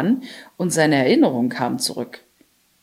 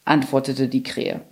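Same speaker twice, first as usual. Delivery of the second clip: plain reading voice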